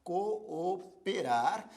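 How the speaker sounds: "cooperar" very slowly split as co, o, perar; syllable by syllable. Shown as a demonstration. Teacher demonstrating division to learners, "cooperar" broken into very slow syllables. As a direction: pause between the two Os of "cooperar". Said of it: "em sílabas diferentes".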